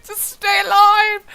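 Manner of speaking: loud, high melodramatic cry; hammed-up desperate plea. Reader playing a friend begging Gino to live, hammed-up desperation. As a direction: wail it like an overblown death scene.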